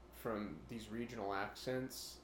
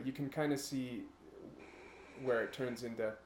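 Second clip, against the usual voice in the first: normal talking voice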